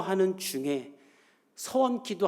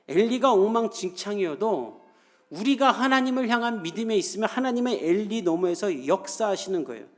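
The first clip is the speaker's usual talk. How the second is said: preaching at full force; 4 semitones higher, 6 dB louder than usual. Acted. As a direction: drive it home to the congregation. Preacher closes the sermon.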